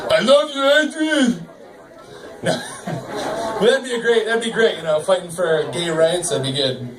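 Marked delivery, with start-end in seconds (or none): 0.1-1.5 Rocky voice. Retold or imitated. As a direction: call out to Adrian like Rocky. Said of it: "And he'd be like".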